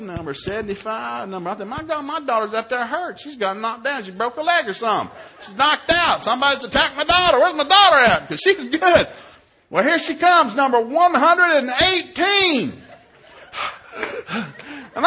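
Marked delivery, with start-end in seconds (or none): 1.7-9.1 higher and louder, building to a yell; mounting panic. Retold or imitated. Dad fearing his daughter is hurt, increasingly panicked. 9.7-12.8 high, drawn-out mock-outraged announcement; loud dismay. Dad spotting his daughter far behind, loudly dismayed.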